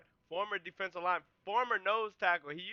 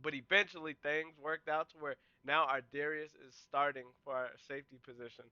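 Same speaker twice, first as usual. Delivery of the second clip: normal talking voice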